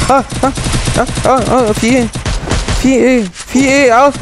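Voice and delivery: high startled what's going on; alarmed what. Real, not acted. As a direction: yelp in surprise. Sudden chaos in game.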